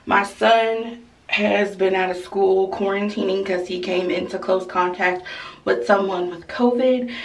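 usual speaking voice

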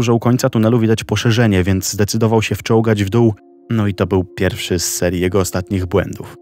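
usual speaking voice

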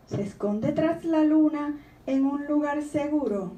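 gentle storybook reading voice; reading a poem aloud. Acted. Author reading her poem to the audience, gently. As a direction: read the child's questions like a poem.

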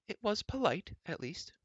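high-pitched princess with a pointed remark. A narrator's reading. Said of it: "Elenwy remarked".

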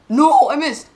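loud, high cry of dismay; real gamer's despairing yell; frustrated yell. Real, not acted. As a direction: shout at the screen as the jump fails. Missing the jump in game.

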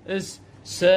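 إس and س hissed without voice, only the s sound; voiceless teaching hiss of س; voiceless letter sound. Shown as a demonstration. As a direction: show the bare hiss of the letter. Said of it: "وهذا الإس".

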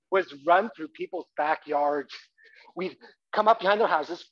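usual speaking voice

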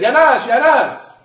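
high, loud, drawn-out call; yelling out. Retold or imitated. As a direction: call out to people approaching from afar. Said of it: "he yells out and said".

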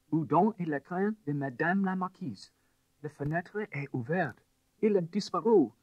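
alarmed French maid. A narrator's reading.

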